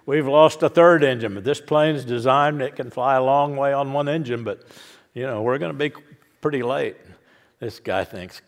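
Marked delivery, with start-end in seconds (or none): 0.1-7.0 loud captain announcement. Retold or imitated. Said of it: "He says".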